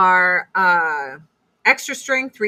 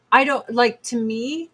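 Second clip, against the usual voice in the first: ordinary talk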